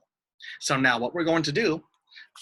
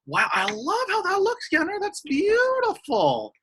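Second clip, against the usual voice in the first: gushing praise; 3 dB louder than usual. Real, not acted. Gushing over a child's card.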